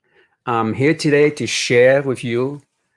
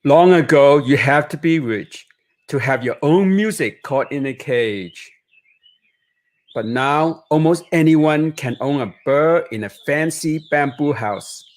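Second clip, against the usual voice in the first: put-on reading aloud to children, lively and higher; picture book narration; 4 semitones higher than usual. A narrator's reading. Author reading his Chinatown memoir to children, expressive storytelling. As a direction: paint each image for young listeners.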